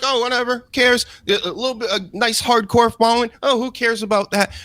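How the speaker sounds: high-pitched imitation of a shrug-off; mock dismissiveness. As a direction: wave it away in a mocking high voice. Acting out dismissive talent.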